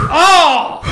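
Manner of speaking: sharp high gasp, then oh; surprised gasp. Real, not acted. Friend holding breath, surprised.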